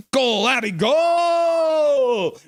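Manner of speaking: rising to a loud high shout on goal; building goal call. Retold or imitated. Voicing a chatter's goal call, building to a high shout. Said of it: "And then Keith says".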